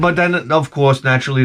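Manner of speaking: ordinary talk, nothing marked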